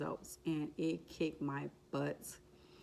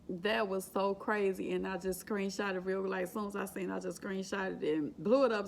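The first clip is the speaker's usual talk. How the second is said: ordinary talk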